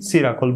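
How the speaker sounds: "সিরাকল" said as si-ra-kol; mispronounced circle. Shown as a demonstration. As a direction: open "সিরাকল" on a "si" sound. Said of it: "বলেছিলাম".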